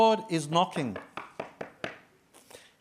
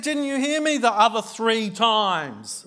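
exasperated; 7 semitones higher, 6 dB louder than usual. Retold or imitated. Retold exasperated wife.

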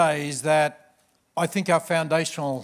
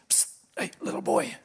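whisper; 3 semitones lower, 5 dB quieter than usual. Acted. Frog calls unseen to boy.

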